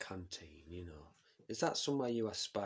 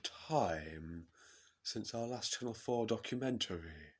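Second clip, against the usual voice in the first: Jacob Rees-Mogg impression. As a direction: put on a ponderous, formal MP's greeting. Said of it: "Jacob Rees-Mogg comes and sits down with".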